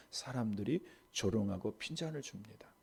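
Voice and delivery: whispered narration of the scorn; hushed, secretive telling. Acted. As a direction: drop to a breathy whisper.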